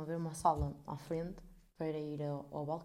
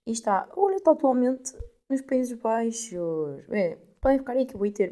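louder, higher, drawn-out complaint; mock outrage; 4 semitones higher, 8 dB louder, slower than usual. Real, not acted. Vlogger complaining to viewers about her late parcel, mock outrage. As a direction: whine it at the parcel stuck abroad.

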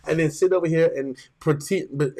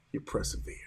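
breathy unvoiced whisper; 12 dB quieter than usual. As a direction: drop to a whisper for the key word. Preacher's hushed climax.

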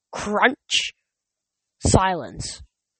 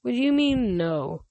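mild, higher-pitched uneasy question; nervous; 5 semitones higher than usual. A narrator's reading. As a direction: ask it hesitantly, drawing it out.

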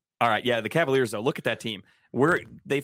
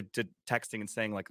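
ordinary talk, nothing marked

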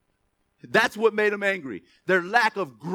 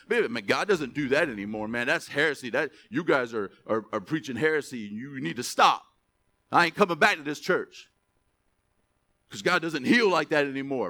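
scoffing churchgoer; 8 semitones lower than usual. Retold or imitated. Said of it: "That's what we would say today".

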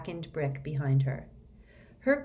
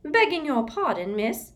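high, louder elf voice; a bowing little elf, polite; squeaky elf; 9 semitones higher, 5 dB louder than usual. Acted. Elf replying to Hermione, squeaky. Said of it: "said the house elf".

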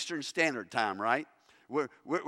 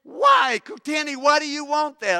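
high, loud startled shriek; retold scream; 12 semitones higher, 9 dB louder than usual. Village lady recoiling at a shocking request, shrieking. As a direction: shriek it in alarm, pitch leaping up. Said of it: "And she screamed".

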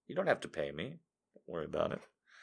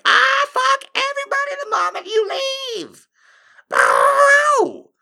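shrieking falsetto outburst; mock tantrum; 10 dB louder than usual. Imitating an ex-girlfriend shrieking as he leaves. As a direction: screech it like a bratty ex. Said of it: "she's all like".

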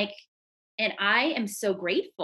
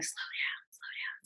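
whispering, much softer; body whispering to slow down; whispered slow down; 9 dB quieter than usual. Retold or imitated. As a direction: hush it like the body pleading. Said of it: "my body's going".

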